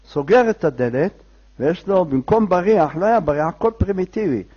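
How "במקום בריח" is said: ordinary delivery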